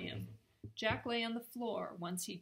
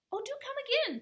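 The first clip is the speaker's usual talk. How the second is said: very high and louder, fussy and eager; fussing Timmerie; 8 dB louder, faster than usual. Acted. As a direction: flutter around him with a high, eager plea. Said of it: "Timmerie fussed".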